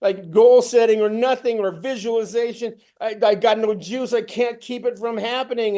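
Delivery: retold despair, mounting; panicked inner voice. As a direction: spiral into doom, pleading. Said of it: "I'm walking around going".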